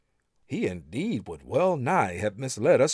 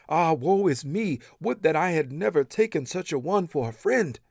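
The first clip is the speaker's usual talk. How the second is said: higher, louder cry of regret; anguished, raised lament of woe; lamenting wail; 7 semitones higher, 4 dB louder than usual. Acted. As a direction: wail it like a man full of remorse. Wrongdoer laments on judgment day.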